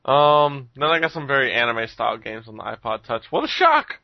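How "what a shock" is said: high-pitched sarcastic astonishment; mock surprise. Host admitting to more anime games on the iPod, mock shock. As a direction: squeal it in fake shock, pitch leaping up.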